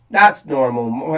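usual speaking voice

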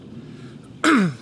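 throat clearing. Real, not acted. Vlog intro, throat clearing.

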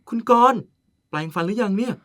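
loud, high exclaim of the name; startled loud teasing cry; sudden call out. Acted. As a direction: call him out loud in alarm.

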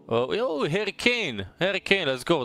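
high, ringing whoop of a name; excited celebration. Real, not acted. Player pulling Harry Kane from a pack, thrilled. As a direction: celebrate the big pull, pitch way up.

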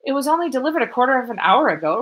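fast, high and loud retort; loud reply. Acted. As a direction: fire it back quickly, getting angry. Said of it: "replied loudly".

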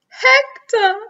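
Hector wailed out high and loud, falling away; wailing. A narrator's reading. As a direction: cry the name Hector as a long, loud wail. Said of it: "she wailed".

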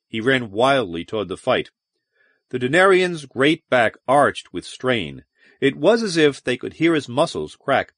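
plain reading voice

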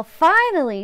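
plain reading voice

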